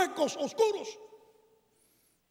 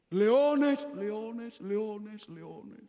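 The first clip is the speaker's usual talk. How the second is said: echo; much slower than usual. Shown as a demonstration. A preacher acts the king's echo in the den, fading. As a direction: make it die off like an echo in a cave. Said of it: "el eco de la misma voz del rey".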